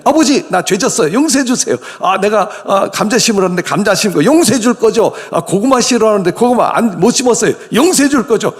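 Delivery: high, whiny, wheedling son's plea; whiny cheeky son. Retold or imitated. Cheeky son wheedling his father for easy forgiveness, high whine. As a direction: wheedle it like a spoiled kid expecting a pass.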